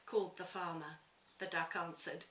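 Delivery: lively picture-book reading aloud; story narration. A narrator's reading. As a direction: read it to children with relish.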